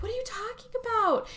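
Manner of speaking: high, innocent question; feigned innocence. Retold or imitated. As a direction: play dumb sweetly.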